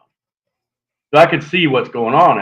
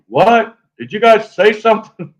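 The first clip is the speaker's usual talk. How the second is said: high, raised-voice walkie-talkie question; oblivious loud buddy; 11 semitones higher, 6 dB louder than usual. Retold or imitated. Headphone-wearing buddy calls his friends on the walkie-talkie, oblivious and loud. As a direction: blurt it out loudly, unaware of danger.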